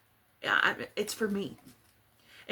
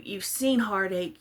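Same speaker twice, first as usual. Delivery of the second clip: ordinary delivery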